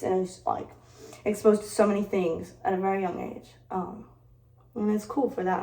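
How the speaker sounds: normal talking voice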